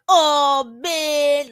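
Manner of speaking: exaggerated put-on voice. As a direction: copy his unnatural put-on voice. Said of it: "whatever kind of voice this is".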